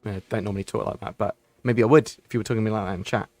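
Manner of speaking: normal talking voice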